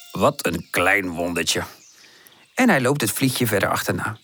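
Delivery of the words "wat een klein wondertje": low, slow tender wonder; admiring. A narrator's reading. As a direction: marvel slowly and deep. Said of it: "Hij kijkt er bewonderend naar". (laag, langzaam en bewonderend)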